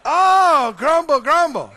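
loud, high grumbling complainer; mock grouch grumbling aloud. Preacher mimics grumbling complainer.

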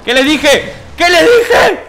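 high, very loud gloating yell; triumphant shouting. Real, not acted. Celebrating a hit in game. (grito triunfal)

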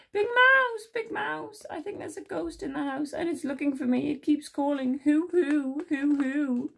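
high squealed call, then anxious telling; frightened Little Mouse. A narrator's reading. Little Mouse tells Big Mouse of a ghost, high frightened voice. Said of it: "feeling very frightened, he called, he cried".

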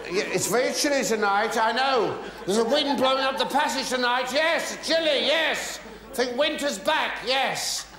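lightly voiced chatty small talk. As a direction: chat brightly to someone who can't hear.

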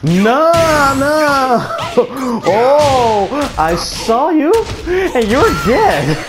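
heated taunt. Real, not acted. Gamer fighting Lily, heated and taunting. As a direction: taunt the rival.

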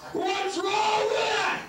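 high, drawn-out laughing shout; high mocking shout. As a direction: yell the catchphrase up high and slow. Repeated mocking catchphrase.

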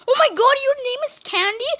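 high, fast, thrilled question; thrilled character. Pretend. Character hears the name Candy, high and fast. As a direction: squeal it out fast, delighted.

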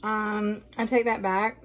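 usual speaking voice

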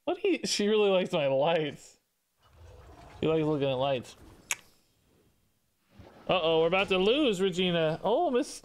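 ordinary delivery

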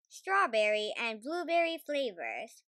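usual speaking voice

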